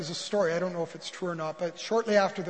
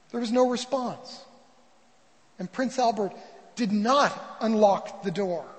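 ordinary talk

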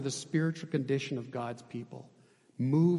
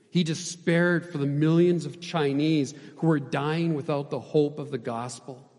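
usual speaking voice

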